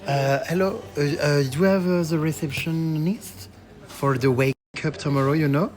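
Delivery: hesitant English with a French accent; real French accent, halting English. Real, not acted. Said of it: "écoutez mon accent anglais".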